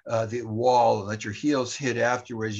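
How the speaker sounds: normal talking voice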